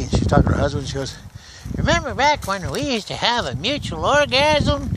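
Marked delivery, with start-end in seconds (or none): 1.8-4.9 put-on high-pitched older woman, swooping pitch. Older woman reminiscing to her husband, in a high voice. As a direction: put on an old wife's high voice. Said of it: "this one older woman sitting there one day".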